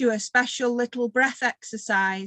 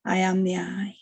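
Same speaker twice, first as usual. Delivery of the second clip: meditative intoning, long silences between phrases; breathing mantra; 2 semitones lower than usual. Shown as a demonstration. Teacher leads group in paced breathing, meditative mantra. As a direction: lift on the in-breath line, settle on the out-breath.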